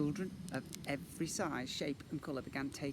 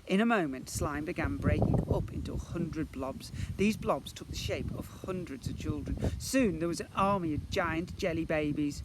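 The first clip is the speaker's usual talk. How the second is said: ordinary delivery, nothing marked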